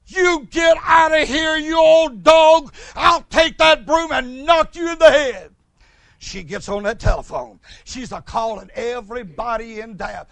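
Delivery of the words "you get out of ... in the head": loud, slow hollering at a dog; angry shout. Retold or imitated. Neighbor shouting at a dog on her porch, loud and angry. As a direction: yell to chase the old dog off with a broom. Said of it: "Here's what she heard".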